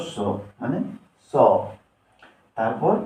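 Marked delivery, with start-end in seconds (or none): 1.3-1.3 স said alone as S's sound; letter sound demo. Shown as a demonstration. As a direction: sound out dental স by itself. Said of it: "S এর সঠিক উচ্চারণ হলো". (স আলাদা করে উচ্চারণ)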